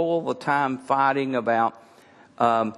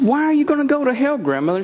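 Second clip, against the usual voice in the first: incredulous; 12 semitones higher, 4 dB louder, faster than usual. Retold or imitated. Retold shock at grandmother's belief.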